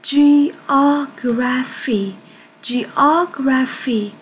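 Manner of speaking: Geography drawn out syllable by syllable; slow clear model word. Shown as a demonstration. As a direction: stretch out each syllable of Geography for the class. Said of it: "Từ này chúng ta đọc là". (đọc chậm từng âm tiết Geography)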